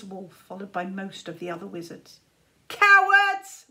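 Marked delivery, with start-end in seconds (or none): 2.7-3.7 much higher, louder roar; a boy roaring at deserters; roared insult. Acted. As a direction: roar it nearly in tears. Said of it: "roared Czar, nearly crying".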